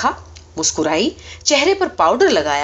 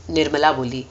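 ordinary delivery

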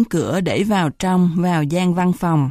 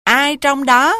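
high, loud booming challenge; booming thunderous voice; 8 semitones higher, 4 dB louder, slower than usual. A narrator's reading. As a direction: boom it out like rolling thunder. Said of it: "một giọng nổ như sấm rền".

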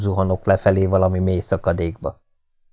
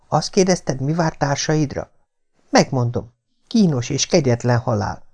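higher-pitched, hushed woman's voice; conspiring woman; 7 semitones higher than usual. A narrator's reading. Woman tempting the man to abandon his companions, conspiratorial.

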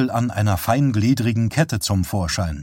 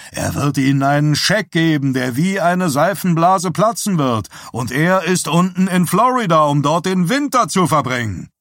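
raised, high-pitched contempt; scornful tirade; 6 semitones higher, 4 dB louder than usual. A narrator's reading. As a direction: jeer at the offer for all to hear. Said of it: "mit unendlicher Verachtung". (höhnisch und verächtlich)

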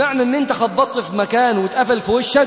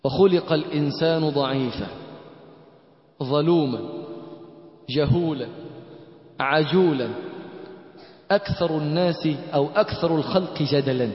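solemn recitation; 7 semitones lower, 6 dB quieter than usual. Acted. Opens with Quranic recitation.

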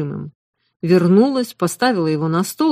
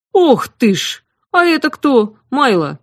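surprised exclamation; 6 semitones higher, 6 dB louder than usual. A narrator's reading. Cook exclaims on seeing girl.

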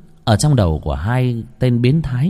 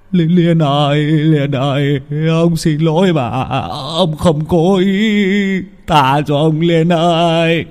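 higher, drawn-out begging voice; weeping plea; 5 semitones higher, slower than usual. Acted. Old man begging the ghost girl to spare him, tearful plea. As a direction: plead for forgiveness, dragging the last words. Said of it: "lão khóc lóc".

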